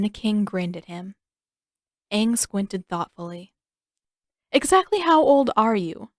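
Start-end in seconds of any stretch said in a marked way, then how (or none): 4.5-6.1 higher, louder eager probe; eager question. A narrator's reading. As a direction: press him, suddenly onto something. Aang probing the king.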